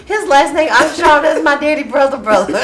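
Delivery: high and loud, cracking up; laughing. Real, not acted. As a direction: keep laughing while explaining. Laughing about her uncle.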